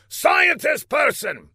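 high, slow summoning shout; villain calling out. A narrator's reading. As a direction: summon an underling from across the room. Villain summons his scientist.

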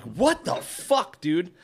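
high, drawn-out retold outrage; pissed off. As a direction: re-enact an exasperated protest, slowly. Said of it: "I'm, like, pissed".

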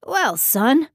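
lightly voiced fatherly man; a hint of a hearty dad; older father voice. Carl voiced by narrator.

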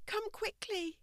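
soft high plea; whimpering. A narrator's reading. Stacy begs Fiona to come, whimpering softly. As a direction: beg her to hurry, barely audible. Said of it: "Stacy whimpered".

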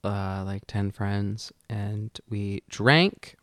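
soft, slow embarrassed confession; sheepish and quiet. Real, not acted. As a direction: admit it under your breath.

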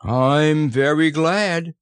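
slow, high, contented bear voice; hearty, drawn-out gladness; happy Pooh. A narrator's reading. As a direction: stretch it out with happy satisfaction. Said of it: "said Pooh happily".

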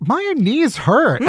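high, drawn-out whine about aching knees; mock complaint. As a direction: stretch it out high, like a comic moan. Retold complaint about sore knees.

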